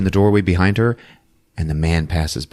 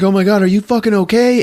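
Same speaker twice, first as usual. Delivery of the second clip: high, loud, rushed question; mock frantic concern; 13 semitones higher, 5 dB louder, faster than usual. Wife checking on her returned husband, mock frantic. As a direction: blurt it out in hammed-up alarm.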